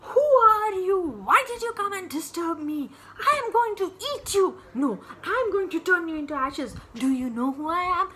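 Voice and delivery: loud, high, rapid-fire threats; angry monster. Acted. Monster threatening the girl who disturbed him, angry shouting. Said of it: "Bhasmasura was so angry".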